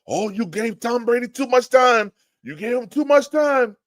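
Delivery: high mocking imitation of a Boston woman's accent; hard Boston accent. Boston fan taunting him about Tom Brady, in a hard Boston accent. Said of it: "that hard Boston accent".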